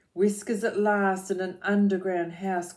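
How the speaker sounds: put-on rhythmic read-aloud for children; lilting picture-book reading; story narration.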